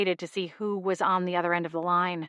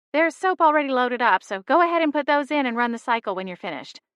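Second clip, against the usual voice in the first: mom voice; 6 semitones higher than usual. Acted. Mom gives dish instructions.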